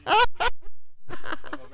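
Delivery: high burst of laughter, then chuckling; real hearty laugh. Real, not acted. Teacher ready to eat someone's sumptuous meal, laughing heartily.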